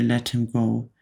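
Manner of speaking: ordinary delivery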